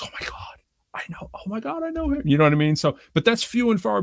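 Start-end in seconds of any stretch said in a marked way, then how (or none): 0.0-1.3 whisper. Retold or imitated. Retold gushing about the husband. 1.4-2.2 excited gush. Retold or imitated. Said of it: "she goes".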